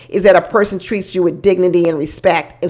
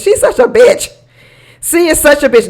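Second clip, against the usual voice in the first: exasperated scorn; 6 semitones higher than usual. Real, not acted. Exasperated verdict on Michelle.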